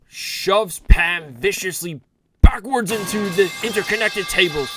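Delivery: ordinary delivery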